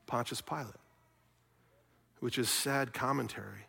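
ordinary talk

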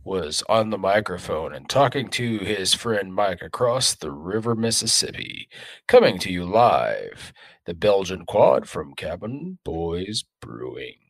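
slow, dramatic trailer narrator; movie preview voice. Acted. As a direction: announce it like an epic movie trailer. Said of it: "movie preview voice".

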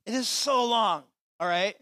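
high, loud, stretched out. Acted. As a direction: plead loudly, leaning on so. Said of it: "I'm really sorry, Justin".

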